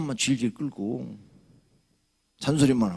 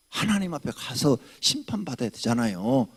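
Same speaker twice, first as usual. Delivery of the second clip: usual speaking voice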